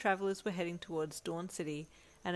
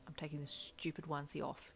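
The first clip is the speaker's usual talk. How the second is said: growl; 2 semitones lower, 7 dB quieter than usual. A narrator's reading. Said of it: "you growled".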